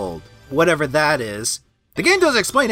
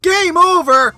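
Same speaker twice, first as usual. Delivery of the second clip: shocked high outraged yelp; raging gamer; 8 semitones higher, 5 dB louder, slower than usual. Real, not acted. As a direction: disbelief at the screen. Shocked at game over.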